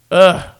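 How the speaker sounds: a short disgusted ugh; frustrated groan. Real, not acted. Fan groaning at his team's offense, short disgusted ugh.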